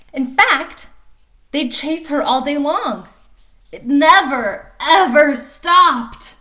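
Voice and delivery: lively storybook reading voice; animated picture-book narration; reading to children. A narrator's reading. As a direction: read it like story time for little kids. Reads picture book aloud.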